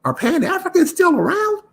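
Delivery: high, incredulous squeak of a question; mock disbelief. Real, not acted. Mocking the show's hosts, with high incredulous disbelief.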